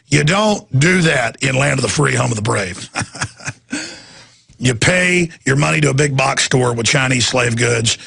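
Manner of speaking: usual speaking voice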